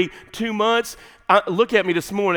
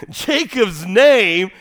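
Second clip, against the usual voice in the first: ordinary talk, nothing marked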